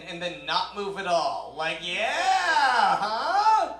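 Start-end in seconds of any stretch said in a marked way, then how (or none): 1.8-3.7 high, loud, drawn-out taunt; hammed-up self-satisfied yell; mock cocky lover. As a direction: crow it as if doing great. Acts out mock seduction.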